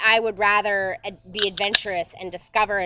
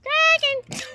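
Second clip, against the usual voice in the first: dramatic Kraken; 4 dB louder than usual. Pretend.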